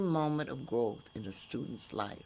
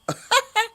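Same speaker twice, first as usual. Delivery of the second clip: high burst of laughter; 11 dB louder than usual. Real, not acted. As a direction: squeal of laughter. Laughing at her own hiccups.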